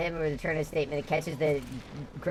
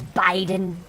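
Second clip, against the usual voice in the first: Trump saying Biden; 3 semitones higher, 4 dB louder than usual. Retold or imitated. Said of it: "I love the way he says".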